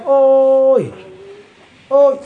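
long, loud cry of oy; loud wailed oy. Acted. Man crying out in the forest, long loud oy. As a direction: wail it out full-voiced.

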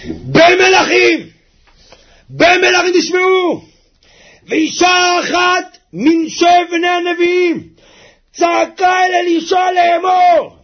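slow, high, loud declaiming; fervent sermon shout; preaching at full force. Acted. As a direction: proclaim it to the congregation. Preacher announces story loudly.

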